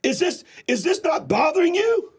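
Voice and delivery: high, slow, indignant shout; outraged shout. Real, not acted. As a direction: hammer the question home. Outraged at unauthorized drone strikes.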